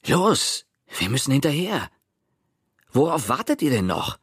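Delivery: high, urgent scolding; barking at the others. A narrator's reading. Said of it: "indem er die anderen anpfiff". (die anderen mit hoher Stimme anpfeifen)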